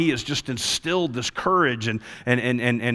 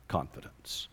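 dramatic whisper; 18 dB quieter than usual. Real, not acted. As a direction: whisper the last word of the line. Preacher drops to a whisper.

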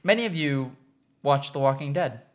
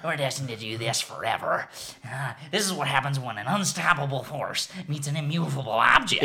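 low, softer villain monologue; retold villain; 6 semitones lower, 5 dB quieter than usual. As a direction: intone it low, as a lightly voiced villain. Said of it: "And then he's like".

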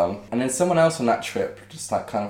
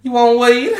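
high, loud mock offer; squeaky-high hawker's call; weed seller impression; 14 semitones higher, 12 dB louder, slower than usual. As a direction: imitate the beach woman pitching weed. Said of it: "And she's like".

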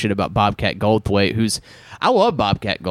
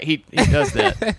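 normal talking voice

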